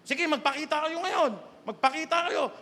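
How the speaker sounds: mock-bold challenge, high and loud; retold challenge. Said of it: "hinamon ko na eh".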